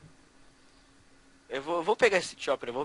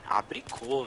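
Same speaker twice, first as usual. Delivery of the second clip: usual speaking voice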